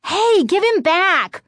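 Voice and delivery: high, loud and slow demand. A narrator's reading. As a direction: insist on getting him back. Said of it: "Chris demanded".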